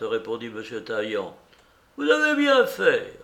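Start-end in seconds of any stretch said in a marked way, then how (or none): 2.0-3.2 high, loud old-man mock approval; deeply ironic. A narrator's reading. Beggar mocking the notary's diligence, high ironic voice. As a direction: approve with exaggerated irony. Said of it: "d'un air profondément ironique".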